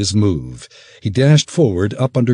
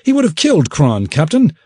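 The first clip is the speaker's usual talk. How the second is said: fast, much higher pleading tattle; said quickly; 10 semitones higher, 5 dB louder, faster than usual. Acted. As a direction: rush it out, eager to blame. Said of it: "Perike said quickly".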